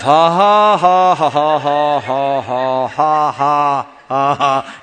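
long, loud run of fake ha-has; stagey sustained laughter; forced laugh. Shown as a demonstration. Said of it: "Pastor said to laugh".

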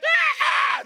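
very high imitated dinosaur shriek; piercing, comic dinosaur scream; T-Rex screech. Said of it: "this time it's like a T-Rex".

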